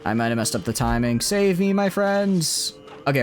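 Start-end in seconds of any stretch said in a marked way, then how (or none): 1.2-2.8 high fluttering mock cries for help. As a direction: wail for rescue up high and slow. Units under attack in war game.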